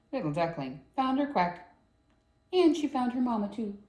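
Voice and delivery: ordinary delivery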